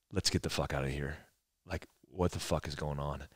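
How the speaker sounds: put-on a mom quietly telling the kids to get out; hushed urgency. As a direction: mom muttering to her son to leave the tent. Mom says leave.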